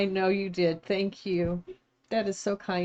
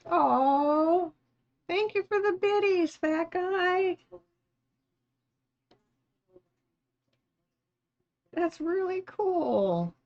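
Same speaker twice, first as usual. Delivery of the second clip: delighted thanks; 10 semitones higher, slower than usual. Real, not acted. Viewer gifts subs on stream.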